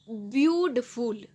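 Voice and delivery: "ब्यूटीफुल" slowly, in stretched, separated syllables; syllable-by-syllable pronunciation. Shown as a demonstration. Teacher showing students how "ब्यूटीफुल" sounds, slow and syllable by syllable. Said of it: "pronunciation हम कर रहे हैं".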